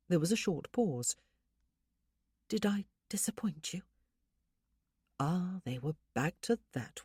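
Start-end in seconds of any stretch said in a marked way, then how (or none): 2.5-3.8 whispered, timid question; anxious whisper. Acted. Wife whispers anxiously to husband whether she disappointed him. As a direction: breathe it out fearfully.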